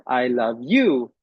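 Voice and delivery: "you" punched with a pitch peak; stress on YOU. Shown as a demonstration. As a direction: save the weight for the final "you". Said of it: "It also creates a different meaning".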